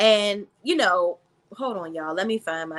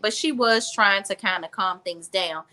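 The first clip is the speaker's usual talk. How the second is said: normal talking voice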